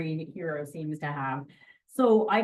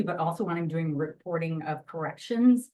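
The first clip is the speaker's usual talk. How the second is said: ordinary talk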